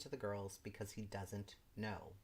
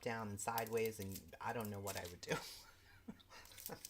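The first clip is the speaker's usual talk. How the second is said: usual speaking voice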